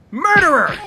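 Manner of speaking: loud, high accusing yell; angry shout. Acted. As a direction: yell it in his face. Accusing Kingpin.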